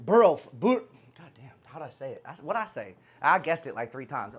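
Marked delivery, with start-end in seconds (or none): none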